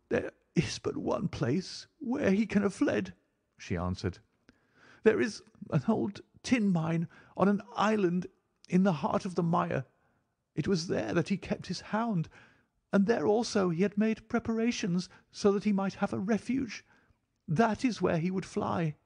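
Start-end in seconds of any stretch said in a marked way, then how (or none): 0.1-3.1 high, halting, intense female voice; distressed woman's voice. A narrator's reading. Narrator voices distressed Mrs Stapleton. 5.0-18.9 high, halting, intense female voice; distressed woman's voice. A narrator's reading. Narrator voices Mrs Stapleton.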